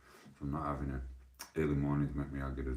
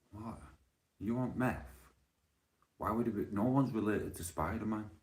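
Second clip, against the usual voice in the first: incredulous; 7 semitones higher than usual. Real, not acted. High incredulous exclamation.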